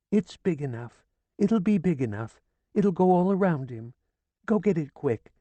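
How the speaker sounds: high, hurried urging; urgent pleading. A narrator's reading. Widow urging Ferdinand to fetch the red cloth, urgent. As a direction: rush him to fetch the cloth.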